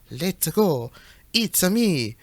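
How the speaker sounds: cartoon Italian catchphrases; lightly put-on Italian accent for Mario's lines. Shown as a demonstration. Listing Mario's catchphrases for friends, Italian accent. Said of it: "catchphrases in an Italian accent".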